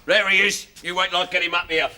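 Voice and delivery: usual speaking voice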